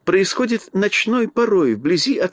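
gracious little queen, drawn out; mouse queen voice. Acted. Queen explains.

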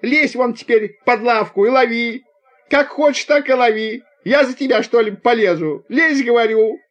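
high, hectoring wail; tearful shouting. A narrator's reading. Conductor ordering the boy under the bench, tearful shouting. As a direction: scold at the top of the range, close to tears. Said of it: "закричала она плачущим голосом".

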